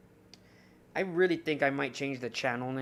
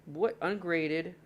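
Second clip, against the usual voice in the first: ordinary delivery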